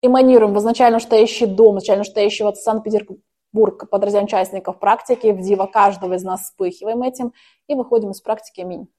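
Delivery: usual speaking voice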